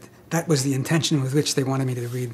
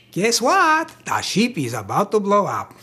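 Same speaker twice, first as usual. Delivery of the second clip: put-on light comic Russian accent, cheeky rather than grave; 5 semitones higher than usual. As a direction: play the Russian officer for laughs, springing the news. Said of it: "could you make it funny".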